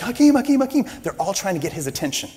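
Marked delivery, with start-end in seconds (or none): 0.0-0.8 high, loud calling of a name; crowd shouting. Retold or imitated. Fans outside the locker room calling the star, high shout. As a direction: yell it like a fan in the crowd. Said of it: "everybody's like".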